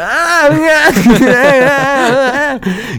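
high mock groans, fading at the end; imitated groaning. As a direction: copy his moaning noises, starting high. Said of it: "he just kept going".

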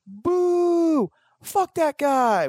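high, slow crowd boo; mock heckle yell. A crowd booing [name] news, mock heckle shout. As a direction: boo like a rowdy heckler in the audience. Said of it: "Perfect example".

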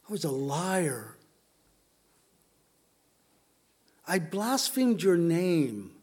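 halting, subdued prayer with long pauses; prayerful confession. Acted. Sinner thanks the Lord for saving him, reverent halting prayer. Prays a testimony aloud.

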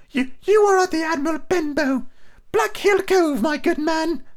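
put-on high, eager boy's voice; young boy voice. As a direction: answer brightly as a young lad. Boy answers the blind beggar.

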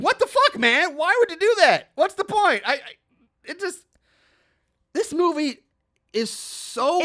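real disbelief at a nonsense plot; incredulous. Real, not acted. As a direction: pitch jumping up in exasperated confusion. Incredulous rant about the movie.

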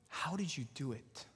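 soft, awed re-enacted question; hushed, sincere amazement; hushed wonder. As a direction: ask it quietly, in disbelief at such kindness. Recalls his astonishment at mom's kindness.

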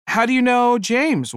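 high, sweet polite lady asking; a lightly voiced polite church lady; polite churchgoer. Polite lady greeting a stranger at the buffet, high and sweet. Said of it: "one of the women asked me politely".

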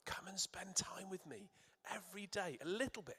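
soft unvoiced whisper of God's invitation; hushed intimate invitation. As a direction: whisper it like a tender secret. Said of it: "what our King says to us".

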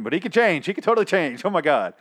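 high, insistent protest; mock desperate defending. Partner defending boyfriend to doubters, mock desperate. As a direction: plead his case, hammed up.

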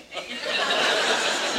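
soft embarrassed chuckle; a rueful breathy laugh; quiet sheepish laugh. Real, not acted. Realizes it's about him.

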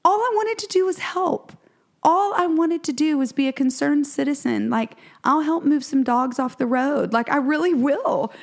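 high, self-righteous complaint; mock-hurt protest at the rude man; indignant protest. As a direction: whine it up high like a wronged helper. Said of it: "And I was like".

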